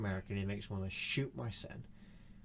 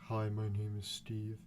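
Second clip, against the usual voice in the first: slow, lower droning voice; dull Steve type; 3 semitones lower than usual. Acted. One of those people introducing himself, dull and slow. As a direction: introduce yourself as a plodding bore. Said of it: "those people that are like".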